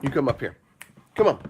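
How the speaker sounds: ordinary delivery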